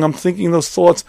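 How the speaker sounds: usual speaking voice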